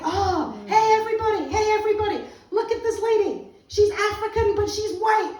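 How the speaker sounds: high, loud imitation of an excited man; mock-announce it to the whole bus; calling out to everyone. Minibus passenger showing off the lady to everybody, loud calling out.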